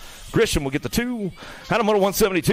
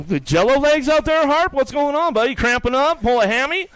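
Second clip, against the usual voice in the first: higher, louder mock taunting; teasing a rider; 9 semitones higher, 4 dB louder than usual. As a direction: rib him like a buddy across the track. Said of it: "I love messing with that guy".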